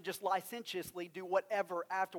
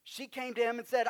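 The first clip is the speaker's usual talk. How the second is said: normal talking voice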